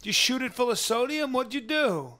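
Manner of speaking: high, incredulous fussing; high-pitched alarm. Acted. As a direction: squeal the questions in hammed-up disbelief. Mock alarm at exposed body.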